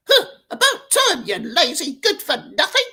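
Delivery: high, loud crone's grumble; grumbling old crone. Acted. Wolf voicing the crone grumbling at the door. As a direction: grumble it like a cranky old hag. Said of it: "grumbled the old crone".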